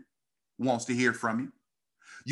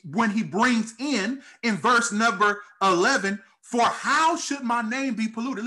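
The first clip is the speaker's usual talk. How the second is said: higher-pitched, insistent sermon delivery; impassioned preaching; 7 semitones higher than usual. Real, not acted. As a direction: press the point home to the congregation. Preaching builds in intensity.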